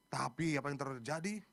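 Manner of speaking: ordinary delivery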